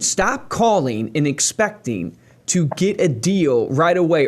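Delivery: normal talking voice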